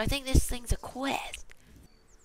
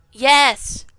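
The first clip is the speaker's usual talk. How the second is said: very loud, higher-pitched cheer; triumphant shout; 4 semitones higher, 18 dB louder than usual. Real, not acted. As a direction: shout it out in victory. Success in the game.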